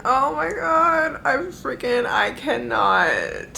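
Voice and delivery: slow, drawn-out wail, starting high; overwhelmed whine. Real, not acted. Reader who cannot cope with this book, wailing. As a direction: stretch each word out, sinking from high.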